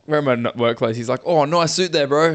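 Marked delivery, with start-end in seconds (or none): 1.2-2.3 higher, louder attempt at a British geezer accent. Retelling what the British bottle-shop geezer said, in a bad British accent. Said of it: "I can't do a British accent".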